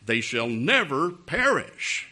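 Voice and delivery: slow, weighty scripture quote; slow, deliberate verse reading. Acted. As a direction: spell out the verse slowly for a doubter. Rereads verse to stubborn listener.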